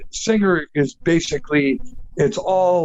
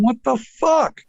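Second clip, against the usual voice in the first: higher, amazed what the fuck; stunned disbelief; 7 semitones higher than usual. Retold or imitated. Band members stunned by the songs, higher disbelieving outburst. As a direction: gasp it out like the band hearing the songs. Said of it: "They're going".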